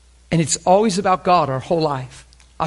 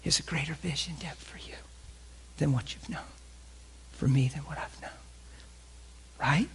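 intimate whisper; 13 dB quieter than usual. Real, not acted. Preacher drops to intimate whisper.

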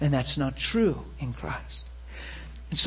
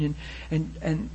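ordinary talk, nothing marked

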